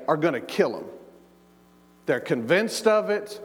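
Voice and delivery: usual speaking voice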